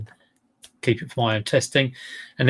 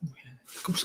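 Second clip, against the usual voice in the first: breathy whispered aside to herself; inner thought whispered; 3 semitones higher, 14 dB quieter, much faster than usual. Retold or imitated. Thinking to herself at the stall, whispered. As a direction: let it slip out as a voiceless whisper. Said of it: "And I was thinking".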